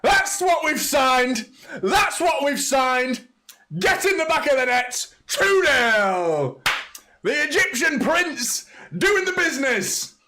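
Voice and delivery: screaming high and loud, drawn out; ecstatic goal scream. Real, not acted. Fan watching live screams the second goal, ecstatic roar.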